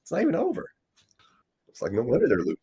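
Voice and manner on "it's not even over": incredulous. Retold or imitated. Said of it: "I was like".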